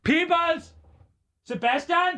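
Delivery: high calls of the name; hammed-up shouting after a friend; mock yell. Host yelling after the cohost who left the studio, mock yell.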